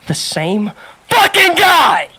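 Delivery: slow, loud, laughing outburst; laughing shout. Real, not acted. Telling friends the supervisor returned, loud laughing shout. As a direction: shout the punchline through a laugh.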